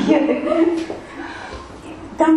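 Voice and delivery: usual speaking voice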